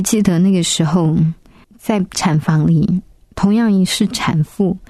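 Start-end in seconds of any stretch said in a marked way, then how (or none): none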